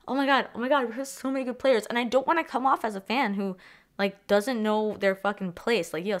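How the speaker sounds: usual speaking voice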